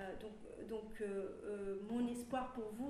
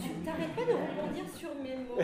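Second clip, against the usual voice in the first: louder, higher, laughing; laughing tease; 6 semitones higher, 10 dB louder than usual. Real, not acted. Teasing a fellow panelist while laughing.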